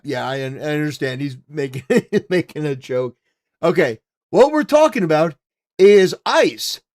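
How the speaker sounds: ordinary talk, nothing marked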